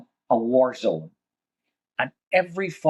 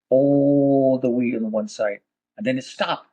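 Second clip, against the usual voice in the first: usual speaking voice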